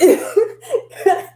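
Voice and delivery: short amused laugh; bright real laugh; high burst of laughter. Real, not acted. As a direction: laugh at yourself. Laughing at own story.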